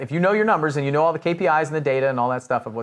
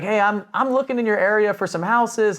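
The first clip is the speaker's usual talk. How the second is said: put-on higher-pitched, light southern accent; 6 semitones higher than usual. House buyer leaving a voicemail, light southern accent. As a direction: imitate an older caller with a southern accent. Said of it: "had, like, a southern accent".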